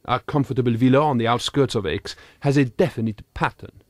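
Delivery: ordinary delivery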